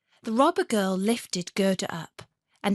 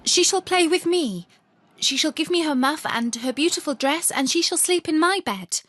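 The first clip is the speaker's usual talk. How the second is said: put-on high, loud spoiled child demanding; bossy robber girl; 8 semitones higher, 4 dB louder than usual. Robber girl claims Gerda as her plaything, high and loud. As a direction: insist like a spoiled little girl.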